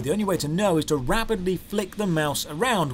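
ordinary delivery

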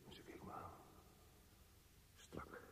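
a barely voiced whisper; tense whisper. A narrator's reading. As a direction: whisper the words, tight and low. Narrator tense about her stare.

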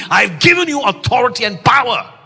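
ringing shout of divine promise; God's voice proclaiming. Acted. Preacher voicing God to the congregation, loud proclamation. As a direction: proclaim it as God speaking, full voice.

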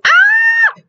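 short piercing scream; grito retenido. Real, not acted. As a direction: release a pent-up high shriek. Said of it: "cuántos gritos he retenido". (grito agudo que se suelta)

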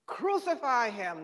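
loud, high-pitched cry of a mob; crowd yell. A narrator's reading. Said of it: "they yell".

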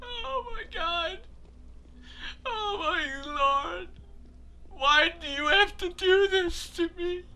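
high, whimpering fake sobs; mock crying. Player crying to Lara over the game, mock crying. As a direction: wail softly in hammed-up misery. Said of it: "Can I cry".

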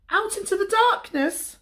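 very high, alarmed cub squeak; Little Bear. A narrator's reading. Little Bear is told they are going out, very high alarmed cub voice.

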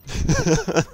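high burst of laughter; laughing. Real, not acted. Spectator laughs as the Kiriko gets whacked, high laugh.

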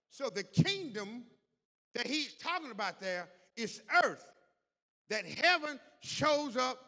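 loud, high, emphatic sermon delivery; full-force preaching. Real, not acted. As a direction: drive each phrase home to the congregation.

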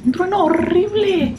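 high, drawn-out cry of alarm; alarmed exclamation. Real, not acted. Vlogger telling viewers of the thunder, alarmed exclamation.

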